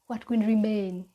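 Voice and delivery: ordinary talk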